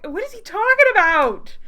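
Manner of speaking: loud, high, incredulous cry; high-pitched exclamation of bafflement; baffled outburst. Real, not acted. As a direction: blurt it out in disbelief.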